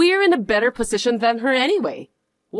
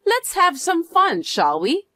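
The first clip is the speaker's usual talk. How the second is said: taunting; 8 semitones higher than usual. Acted. Taunting rival at restaurant.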